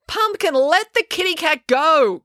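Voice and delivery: high, drawn-out call to a dog. A narrator's reading. April calls to her dog chasing a cat, calling out. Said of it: "called April".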